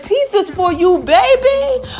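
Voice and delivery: high, loud, squealed with a laugh. Real, not acted. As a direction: cheer the viewers on with a giggle. Hyping viewers, laughing.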